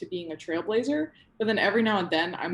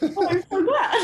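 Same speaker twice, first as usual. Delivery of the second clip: high laughing reply; 12 semitones higher, 7 dB louder than usual. Real, not acted. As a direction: laugh through the words with delight. Laughing after thanks.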